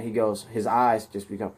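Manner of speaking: ordinary delivery, nothing marked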